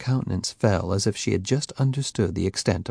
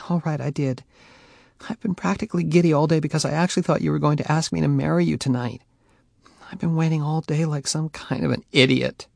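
giddy, hurt confession; 7 semitones higher, faster than usual. A narrator's reading. Faye confesses.

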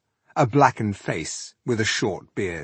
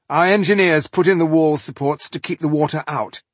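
raised, louder boast; proud; 7 semitones higher, 5 dB louder than usual. A narrator's reading. Tribesman describing his engineers' achievement, openly proud. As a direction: show off your people's work. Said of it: "did not conceal his pride".

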